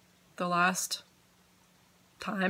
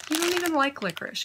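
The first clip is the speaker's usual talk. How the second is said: teasing scold to cat; 9 semitones higher, faster than usual. Real, not acted.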